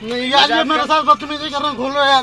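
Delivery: upset panicked protest. Real, not acted. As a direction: still shaken and pleading. Passenger still panicked after reveal. (परेशान होकर ज़ोर से विरोध)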